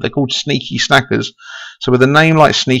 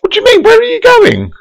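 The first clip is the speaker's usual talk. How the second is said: high, alarmed double-take; suddenly high and startled; surprised; 5 dB louder than usual. Real, not acted. Co-caster says he's leaving.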